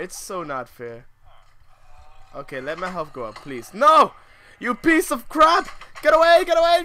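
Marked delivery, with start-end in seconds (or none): 3.7-6.8 high, loud panic screams at the mobs; real frantic yelling, much higher and louder; panicked yelling. Real, not acted. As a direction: scream at them to get away.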